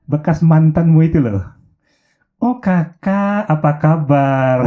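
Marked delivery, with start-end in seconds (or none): 2.4-4.6 slow drawn-out delighted greeting; surprised greeting. Acted. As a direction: stretch it out like a pleased surprise. Melissa greets her old ex. (sapaan pelan memanjang, senang terkejut)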